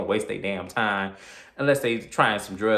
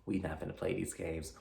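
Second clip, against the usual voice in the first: scared denial; 3 semitones lower, 13 dB quieter than usual. Retold or imitated. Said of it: "And I'm like".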